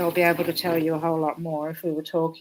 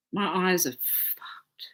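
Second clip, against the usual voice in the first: whispered; 5 dB quieter, much slower than usual. Real, not acted. Quiet aside about her eyes.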